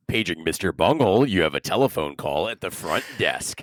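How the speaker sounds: low, deep hotel paging announcement; over a PA system. Pretend. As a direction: page him like a front desk intercom. Mock front-desk page.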